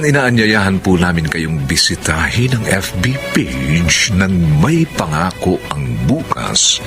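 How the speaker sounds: normal talking voice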